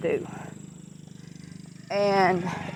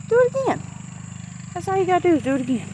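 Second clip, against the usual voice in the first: emphatic urging; 10 semitones higher, much faster than usual. Real, not acted. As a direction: urge them on, voice leaping up. Urging viewer.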